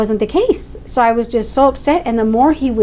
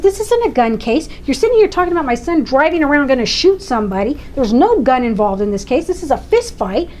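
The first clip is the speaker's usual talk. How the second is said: high, heated protest; indignant anger; 3 semitones higher than usual. Retold or imitated. Mother protesting to the district attorney, heated and high. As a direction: scold him for twisting the case. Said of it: "so frustrated and so angry".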